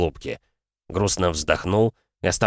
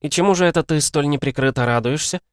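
put-on young girl voice; 7 semitones higher than usual. Girl's voice, bored and doubting.

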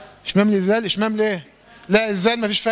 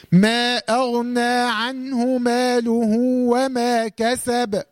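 slow, measured tajweed recitation; Quran recitation; 2 semitones higher, much slower than usual. Shown as a demonstration. Teacher shows student the verse in slow recitation.

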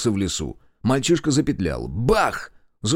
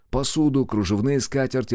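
plain reading voice

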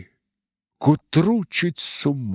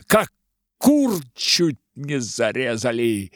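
angry rant; 5 semitones higher, 3 dB louder than usual. Acted. Frozen officer ranting.

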